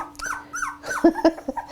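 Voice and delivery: light, high laugh; soft high-pitched giggle. Real, not acted. Breeder laughing at the puppy's mess, amused.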